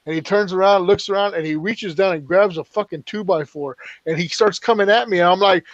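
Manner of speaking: ordinary talk, nothing marked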